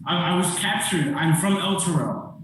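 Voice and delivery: high, frightened begging; kneeling plea. Acted. Kneeling captive begs the party for mercy, frightened pleading.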